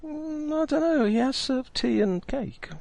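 high, slow innocent protest; defending host. Acted. Game master defending the kindly host, high slow voice. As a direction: insist he seems nice. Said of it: "GM defending the NPC".

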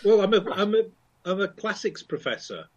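usual speaking voice

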